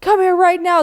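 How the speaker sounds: high, loud insistent call; urgent shouted order, high and loud; urgent demand. Retold or imitated. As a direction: demand she come at once. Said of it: "And Jane goes".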